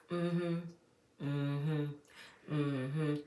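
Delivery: low, soft "mm-hmm"; mimicked agreeing hum. Imitating the aunt backing her sister, low agreeing hums. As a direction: hum spaced-out, knowing agreement.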